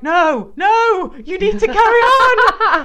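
loud, high, laughing whine; pleading husband. Retold or imitated. Husband refusing to take over, loud high laughing whine. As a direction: whine back in mock panic. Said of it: "And he'd be like".

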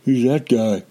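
mock wary, sizing up a stranger; suspicious. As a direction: act mildly suspicious of someone. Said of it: "he's a little bit suspicious".

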